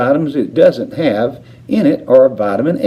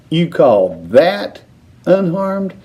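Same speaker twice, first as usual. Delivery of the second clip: snapped; 7 semitones higher, slower than usual. A narrator's reading. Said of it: "snapped Missus Teavee".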